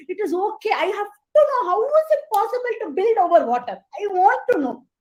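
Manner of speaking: eager squirrel insisting. Acted. Squirrel insisting it must know.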